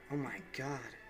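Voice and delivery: mild shocked murmur; dry-mouthed horror. Acted. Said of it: "his mouth dry".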